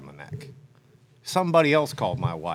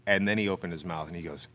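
ordinary delivery

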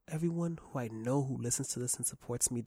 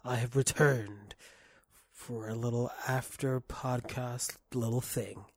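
ordinary talk

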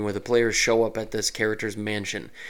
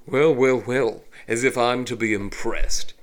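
elegant, smug elf villain; cool, disdainful villain line; refined villain voice; 4 dB louder than usual. Shown as a demonstration. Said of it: "The evil villain just says his line".

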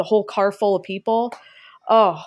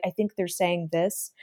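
much softer, lower voice of a manager muttering on the back line; manager on the secret speaker; 3 semitones lower, 8 dB quieter than usual. Retold or imitated. Imagining the manager talking about her on the hidden speaker, much softer. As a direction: say it low, like a private aside over the headset. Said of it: "the manager can be like".

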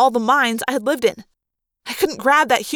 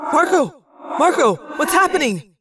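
worried calling; 4 semitones higher than usual. Acted. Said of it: "Tobias answered".

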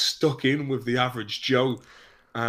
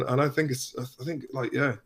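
ordinary talk

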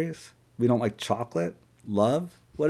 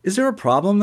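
voiced manager; 9 semitones higher, 3 dB louder than usual.

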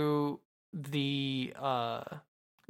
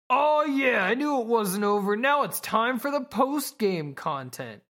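doofy himbo voice; 6 semitones higher than usual. Retold or imitated. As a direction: play a dopey himbo who thinks it's a video game. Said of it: "in his doofy-ass himbo way".

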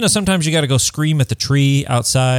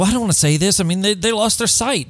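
hesitant guilty voice; 6 semitones higher, faster than usual. Retold or imitated. Sighted supporter voiced, feeling guilty.

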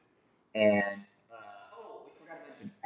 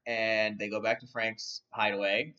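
normal talking voice

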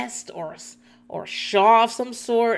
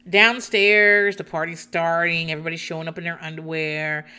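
usual speaking voice

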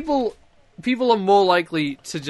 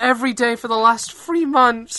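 pleading shop worker; 8 semitones higher, 5 dB louder than usual. Pretend.

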